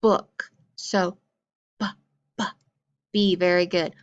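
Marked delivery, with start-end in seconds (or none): 1.8-2.4 "b, b" as two short separated sounds; isolated B sound. Shown as a demonstration. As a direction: say the sound "b" twice, not its name. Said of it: "make the word book".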